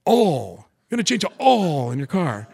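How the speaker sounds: put-on Alabama accent, "oil" flattened to a long "awl"; manly Alabama drawl. Alabama coworker shows manly "oil", Southern "awl" drawl. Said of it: "You gotta say it like a man".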